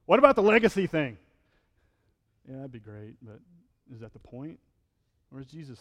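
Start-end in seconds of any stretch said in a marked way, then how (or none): 0.0-1.2 raised, louder, mock-indignant pushback; half-joking protest; mock objection. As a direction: needle him like a skeptic. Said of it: "I'm like".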